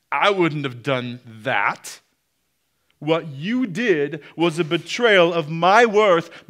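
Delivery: lightly voiced self-righteous grudge-holder; mild mock indignation, slow and pressing; unforgiving person. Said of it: "You're saying, in effect".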